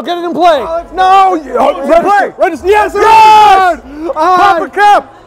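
normal talking voice

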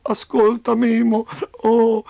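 high, loud groaning plea; moaning. A narrator's reading. Injured Roby calling on Memo, moaning. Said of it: "gemette Roby".

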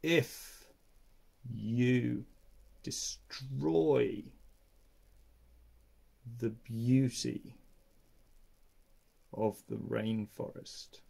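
very slow, soft, long-paused sloth voice; deep lazy sloth. Acted. Three-toed sloth asking the sleeping man slowly, deep and lazy. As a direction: drag each word out lazily. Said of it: "her deep and lazy voice".

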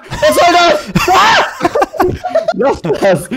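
frantic high yelling while dying; panicking gamer. Real, not acted. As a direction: scream in panic as you lose. Dying to golem, screaming.

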